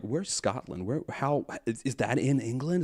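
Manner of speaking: baffled American. Retold or imitated. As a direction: imitate a clueless American puzzling it out. Imitating baffled Americans asking.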